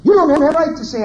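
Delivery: high, loud mock parent shouting down the child; yelling parent. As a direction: snap at the kid to silence him. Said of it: "what those parents say".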